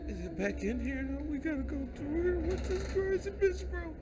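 high frightened voice; being chased. Real, not acted. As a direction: panic as it appears. Monster reappears in game.

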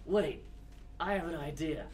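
ordinary talk, nothing marked

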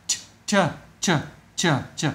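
"ch" repeated alone with short gaps; isolated ch sound. Shown as a demonstration. Teacher drilling a sound for pupils, isolated ch sound. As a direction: sound out "ch" by itself, several times. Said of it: "âm này các bạn đọc là".

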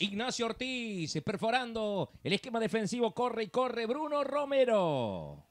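slow, stretched player names; live play-by-play. Real, not acted. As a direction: draw out the names as the attack builds. Commentator calls a running attack.

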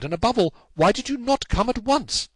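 high, loud reproach; raving cry. Acted. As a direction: cry out in exasperation at the delay. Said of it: "he cried".

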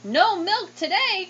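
high, loud, slow wail; cried out. Acted. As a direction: cry it out in dismay. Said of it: "cried Farmer Brown".

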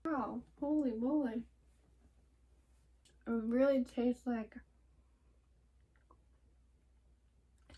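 talking behind hand over mouth; speaking while swallowing candy; mouth full chewing. Real, not acted. Seen 0: bites candy at -3, cheeks full, chews behind hand till 7.6.

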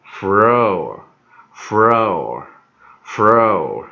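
floor stretched out very slowly and loudly; slow drawn-out demo. Shown as a demonstration. Teacher modelling floor for learners, slow and loud. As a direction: drill floor slowly, holding the or. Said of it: "O R念or".

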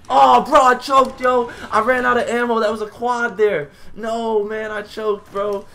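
loud, high-pitched yelling in dismay; loud high wail of frustration, easing slightly; anguished gamer outburst. Real, not acted. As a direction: cry out over a blown play. Just choked a quad in game.

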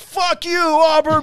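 put-on very high, loud drunken outburst; hammered fan yelling back at the Auburn taunt; drunk outraged yell. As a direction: scream it like your team was insulted. Drunk fan furious at rival team.

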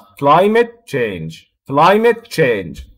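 very slow, climate stressed and change dropped. Shown as a demonstration. Teacher repeating the example for students, slow and stressed. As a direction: drag it out and lean on climate. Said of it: "تركيز الستريس وين؟ بيكون على climate".

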